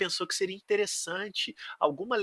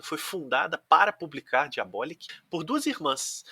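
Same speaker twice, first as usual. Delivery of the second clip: ordinary talk, nothing marked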